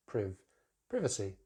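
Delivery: priv, then privacy with short i; British pronunciation. Shown as a demonstration. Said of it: "But in British English".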